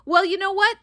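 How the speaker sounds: ordinary delivery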